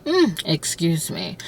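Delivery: ordinary talk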